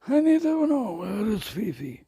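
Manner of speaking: squeaky worried pet voice; voicing the cat. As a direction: plead for Fifi as the fretful cat. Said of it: "he doesn't know where Fifi is".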